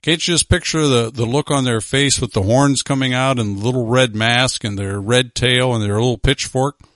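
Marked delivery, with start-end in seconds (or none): none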